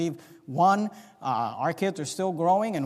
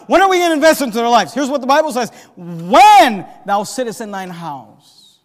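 loud, raised, insistent sermon; full-force preaching; 5 semitones higher, 11 dB louder than usual. Acted. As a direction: fire the questions at the congregation.

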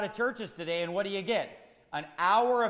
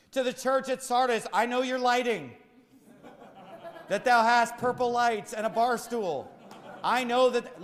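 mock letter to the churches; 3 semitones higher, 5 dB louder than usual. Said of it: "Is that what he told Ephesus?".

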